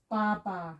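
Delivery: ba, ba split into two clear syllables. Shown as a demonstration. Teacher sounding out a two-syllable Hebrew word. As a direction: separate ba and ba distinctly. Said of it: "So it's two syllables".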